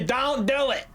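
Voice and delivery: high-pitched mock warning; comic warning. Repeats it comically after laughing.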